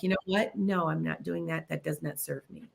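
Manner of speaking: quiet, composed acted refusal; balanced refusal. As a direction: set a boundary softly, without any fight. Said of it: "start to balance your energy out".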